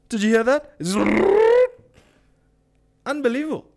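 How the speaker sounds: high, loud gossipy voice breaking into a laugh; mocking gossip. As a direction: ham up a shocked gossip, laughing.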